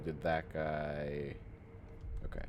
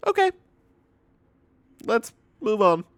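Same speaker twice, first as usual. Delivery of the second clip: much higher, louder strained escape; exasperated; 12 dB louder than usual. Retold or imitated. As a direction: squeak it out, desperate to change topic. Exasperated retold reply.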